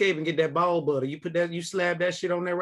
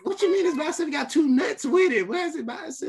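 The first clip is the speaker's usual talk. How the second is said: incredulous; 9 semitones higher than usual. Real, not acted. Incredulous questions, pitch up.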